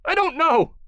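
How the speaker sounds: very high, loud panicked denial; terrified, protesting; high squeal. Acted. Beaten captive denying.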